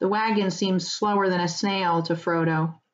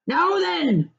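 much higher, firm rustic challenge; stern farmer; 11 semitones higher than usual. Acted. Farmer confronts the rider, firm rustic challenge. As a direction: square up to a stranger. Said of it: "said the farmer".

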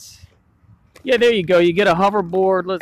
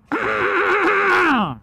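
ghostly wail. Acted. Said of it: "I was trying to do a little ghostly voice".

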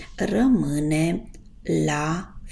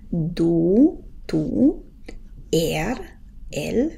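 ordinary talk, nothing marked

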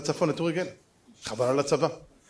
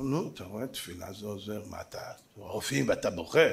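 much softer, gentle rebuke; soft chiding; 10 semitones lower, 10 dB quieter than usual. Retold or imitated. Rabbi gently tells the youth prayer works, softly. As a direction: murmur a kind scolding.

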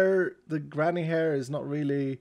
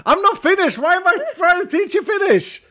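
high, loud frantic outburst; comic exasperation; 8 dB louder than usual. Real, not acted. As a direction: protest loudly at the absurdity. Exasperated at own lesson.

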